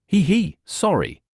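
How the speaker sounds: half-laugh the sorry; chuckling. Acted. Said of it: "Courage chuckled".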